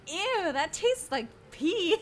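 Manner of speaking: put-on high, slow little-kid "ew"; childish disgust. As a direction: whine it like a six-year-old. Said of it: "and I said".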